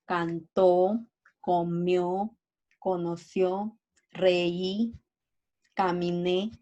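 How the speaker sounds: canTÓ, coMIÓ, conoCIÓ, reÍ, camiNÉ, each ending held; last-syllable stress. Shown as a demonstration. Teacher giving examples of agudas, final stress on cantó, comió, conoció, reí, caminé. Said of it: "en la última sílaba".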